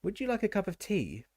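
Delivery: high, eager, servile; bow and scrape with the tea; fawning offer. A narrator's reading. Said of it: "sunk into a kind of half bow".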